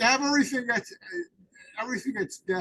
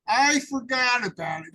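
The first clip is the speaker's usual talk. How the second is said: louder and firm, leaning on I; louder, emphatic; 4 semitones higher, 7 dB louder than usual. Shown as a demonstration. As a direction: declare it out loud like a confession. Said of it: "John said".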